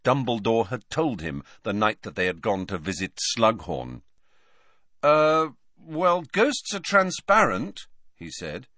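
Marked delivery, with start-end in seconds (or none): 5.0-7.9 hesitant Harry. A narrator's reading. Harry answers unsure.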